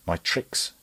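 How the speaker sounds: ordinary delivery, nothing marked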